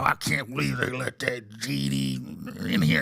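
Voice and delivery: put-on low, muffled old-man grumble. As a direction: imitate grumbling old billionaires in an undertone.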